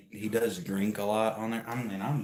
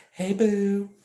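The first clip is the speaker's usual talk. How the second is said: high, louder mock-flirty greeting; flirty coo; 12 semitones higher, 7 dB louder than usual. Mocking the boo basket giver, flirty cooing greeting. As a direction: call out to her sweetly.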